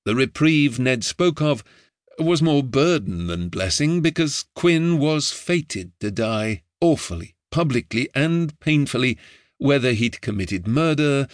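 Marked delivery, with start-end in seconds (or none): none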